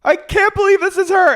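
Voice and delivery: extremely high imitated screech; hammed-up disbelief. Housewife learning who it is, mock hysterical shriek. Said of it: "You hear her go".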